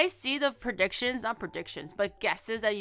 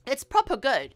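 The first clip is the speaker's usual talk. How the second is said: louder, British "it's proper good"; 5 dB louder than usual. Shown as a demonstration. Streamer explaining British slang in a mock accent. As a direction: show how Brits say it. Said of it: "how to speak, um, British English".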